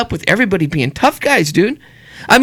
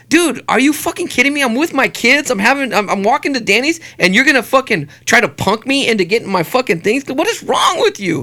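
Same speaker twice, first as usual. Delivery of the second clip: higher-pitched, exasperated retold rant; indignant attitude; 4 semitones higher than usual. Dad with kids tells off two strangers, indignant. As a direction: demand an explanation, outraged. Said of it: "my attitude was like".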